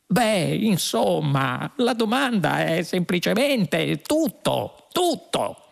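slow, high-pitched helpless plea; prostrate. A narrator's reading. Fuog struggles to name the question, prostrate. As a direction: drag it out, voice climbing helplessly.

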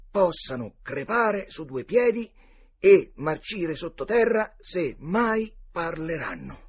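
emphatic, high, loud proclamation; solemn oath read aloud. Acted. Two boys' oath of secrecy read out, solemnly declaimed. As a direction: declaim each phrase of a sworn pact.

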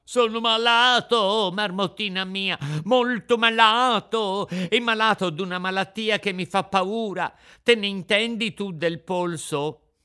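a scared little puppet begging for help; frightened puppet. A narrator's reading. Pinocchio voiced by narrator.

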